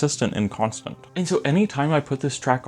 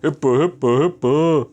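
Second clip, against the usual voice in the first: hippa sung loud and high like a cartoon plumber; Mario-like voice; 5 semitones higher, 6 dB louder, slower than usual. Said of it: "it just sounds like a Mario thing".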